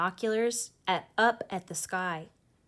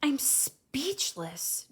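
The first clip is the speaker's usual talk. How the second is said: higher, very slow hushed awe; awed; 7 semitones higher, much slower than usual. A narrator's reading. Brother stares up at the meteors, slow awe. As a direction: let it linger in wonder. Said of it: "Jamal says".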